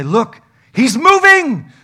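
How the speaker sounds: high, loud and slow punchline yell; mock alarm at a moving corpse; excited shout. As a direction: shout it like the corpse just twitched.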